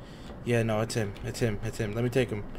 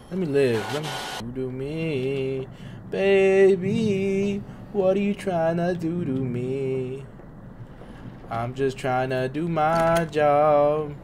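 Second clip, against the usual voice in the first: slow pleading; 4 semitones higher, 4 dB louder, much slower than usual. Real, not acted. Pleading with the game slowly.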